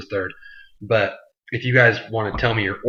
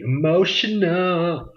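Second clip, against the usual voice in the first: drawn-out, much higher, hammed-up sob on the word; mock emotional wail; 8 semitones higher, much slower than usual. Vlogger echoing his own word in a mock choked-up wail. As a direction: stretch it out slow like you're about to cry, half joking.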